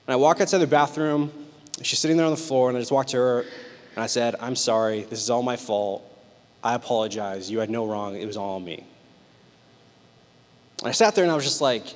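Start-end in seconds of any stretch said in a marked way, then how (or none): 4.4-8.8 low, soft, humble confession; sincere apology. Retold or imitated. Husband apologizing to wife on the floor, soft and sincere. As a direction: take the blame quietly and tenderly.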